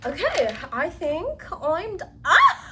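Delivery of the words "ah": sudden high squeal of delight; excited shriek. Real, not acted. Vlogger squeals at her finished furniture, excited shriek. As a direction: let out a shrill squeal of joy. Overexcited squeal mid-sentence.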